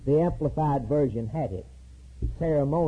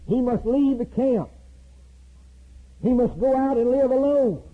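ordinary delivery

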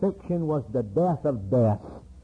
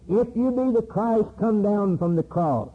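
mocking cry; 6 semitones higher than usual.

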